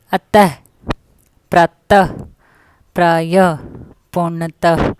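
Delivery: अतः, प्रातः said with a clear breathy h at the end; visarga with h sound. Shown as a demonstration. Teacher showing children the visarga, pronounced with a final h. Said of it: "देखो कैसे बोलते हैं".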